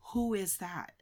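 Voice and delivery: softly deadpan question; straight-faced. Retold or imitated. Lady at the bag check not knowing the artist, straight-faced. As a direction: ask it softly, completely serious. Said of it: "with a straight face".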